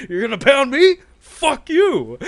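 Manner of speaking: high, loud mock shout from behind camera; director's voice from behind the camera, high and loud; mock outraged yell. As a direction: scream it back in disbelief. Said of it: "you just hear this voice".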